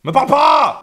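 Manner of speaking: high, loud angry outburst; hammed-up furious rebuff. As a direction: shout it to push them away. Repeats it as angry snap.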